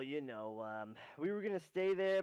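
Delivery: ordinary talk, nothing marked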